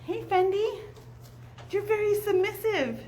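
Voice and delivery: high, sweet coo to a shy dog. Real, not acted. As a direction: coax the nervous dog gently. Cooing at shelter dog.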